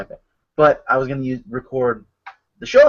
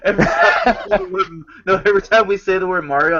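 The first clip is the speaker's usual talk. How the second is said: fast words broken by laughter; laughing; 5 semitones higher, faster than usual. Real, not acted. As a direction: laugh through the sentence. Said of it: "No, 'cause I was laughing".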